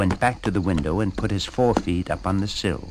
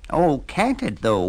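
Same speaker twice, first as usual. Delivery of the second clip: high wailing cat voice; 6 semitones higher than usual. Acted. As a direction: wail it like a miserable cat. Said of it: "wailed the cat".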